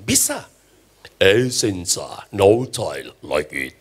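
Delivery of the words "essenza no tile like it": even deeper, very slow announcer voice; better ad voiceover. Pretend. The preacher tops the original ad with an even deeper, slower voiceover. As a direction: stretch the slogan out, rich and low. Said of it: "Saya bisa bikin jauh lebih bagus dari itu".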